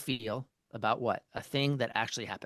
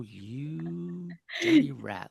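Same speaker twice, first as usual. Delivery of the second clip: very slow, higher, dragged-out sneer; seething insult; 6 semitones higher, 5 dB louder, much slower than usual. Retold or imitated. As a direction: savour the insult slowly like a cartoon villain. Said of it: "I'm fuming more and more".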